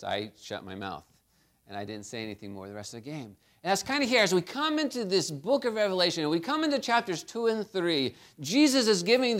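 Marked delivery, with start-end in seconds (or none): 0.0-3.4 dropped low and soft; hushed. Retold or imitated. Preacher recalling keeping quiet, low and soft. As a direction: let it fall quiet, like shutting up. Hushed after choosing silence.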